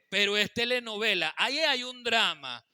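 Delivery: loud, driving sermon delivery; loud pulpit voice building the point; full-force preaching. Acted.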